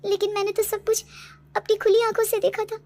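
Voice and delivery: high, loud, disbelieving insistence; distraught protest. Acted. Daughter insists it was no dream. (घबराहट भरी ऊँची आवाज़ में ज़िद)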